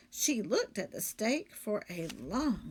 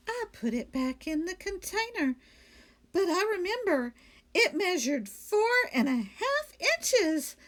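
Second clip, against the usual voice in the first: higher, louder innocent maid; Amelia Bedelia; 9 semitones higher, 4 dB louder than usual. Acted. Maid explaining the lights to her employer, higher and innocent. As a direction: explain it brightly and sure of herself.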